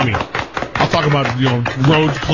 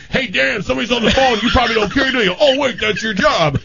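higher, sarcastic nagging voice; mock Frank nagging; 11 semitones higher than usual. Host mimicking Frank needling producer Dan, sarcastic. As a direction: needle Dan like a grumpy boss. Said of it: "Frank will say stuff like".

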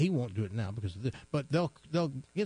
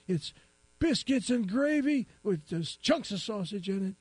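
put-on high, teary old man voice; 7 semitones higher than usual. Crying old man tells the policeman his news, high and weepy. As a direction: play a weepy old timer. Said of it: "old man in joke".

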